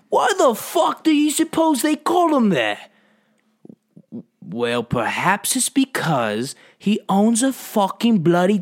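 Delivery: high, loud British indignation; hammed-up British outburst, drawn out; mock British accent. Exaggerated British movie-style bit.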